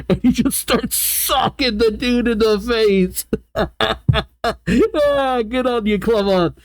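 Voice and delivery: high, amused voice breaking into laughs; laughing. Real, not acted. Reader delighted by Clement's punch, laughing. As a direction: laugh through the telling.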